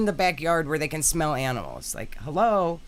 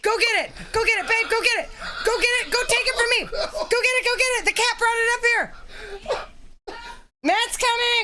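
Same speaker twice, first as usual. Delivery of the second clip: very high, loud frantic shrieks; real panic, shriek for help; screaming in alarm as the cat drags a live bunny in; panicked screaming; 4 dB louder than usual. Real, not acted. Cat brings live baby bunny inside.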